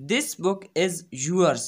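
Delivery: very slow, word by word; careful classroom reading; slow teaching read. Shown as a demonstration. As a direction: dictate it slowly. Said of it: "अब ये".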